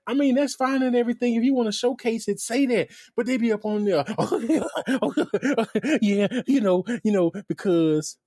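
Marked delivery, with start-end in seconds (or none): 4.2-8.2 high, laughing babble imitation; mock cackling. Host mocking giggly live-stream presenters, cackling high. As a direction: imitate hosts cackling their way through empty filler. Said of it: "all they do is just cackle".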